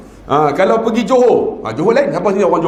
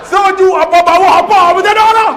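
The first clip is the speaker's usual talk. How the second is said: higher, louder comic yell; higher, louder Negeri Sembilan panic yell; comic shout; 12 semitones higher, 6 dB louder than usual. Retold or imitated. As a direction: yell in mock panic as the cloth drops. Wife's cloth falls off.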